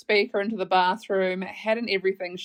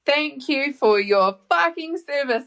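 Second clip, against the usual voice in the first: slow, higher-pitched mock disbelief; incredulous repeat; 5 semitones higher, 4 dB louder than usual. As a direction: stretch it out, stressing FUCKING in sheer disbelief. Repeats his line back in disbelief.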